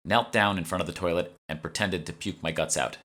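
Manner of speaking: usual speaking voice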